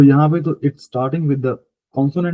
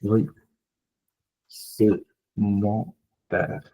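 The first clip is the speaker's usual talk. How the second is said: slow model dialogue; 2 semitones lower, much slower than usual. Shown as a demonstration. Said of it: "Other example।".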